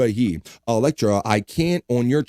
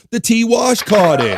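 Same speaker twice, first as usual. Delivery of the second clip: high, loud, drawn-out call; excited winner announcement; 10 semitones higher, 5 dB louder, slower than usual. Real, not acted. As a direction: sing out the winner's name. Host announces who caught gift.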